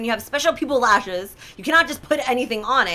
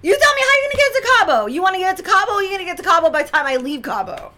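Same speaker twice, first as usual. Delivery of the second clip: loud, high-pitched retold rant; pissed-off outburst; 10 semitones higher, 5 dB louder, faster than usual. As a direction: explode at the airline staff, high and fast, easing slightly by the end. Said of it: "I'm like, I was pissed".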